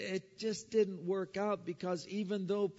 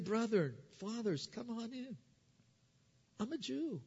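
tender welcome; 5 semitones higher, 6 dB quieter than usual. Acted. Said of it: "a very endearing term".

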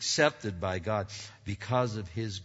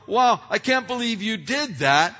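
mock disappointment; 12 semitones higher, 11 dB louder than usual. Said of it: "we're going".

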